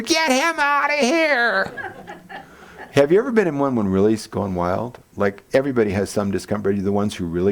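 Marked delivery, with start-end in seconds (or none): 0.0-1.9 high, slow hammed-up shriek through a laugh; mock yell. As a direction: play the furious wife, laughing. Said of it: "She's pushing the buzzer".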